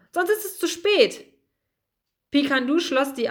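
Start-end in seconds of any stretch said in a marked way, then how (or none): 0.1-1.2 higher, urgent call. Acted. Bertram urges Pikandu to jump, urgent high call. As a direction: call up to him, hurry him. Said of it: "rief Bertram".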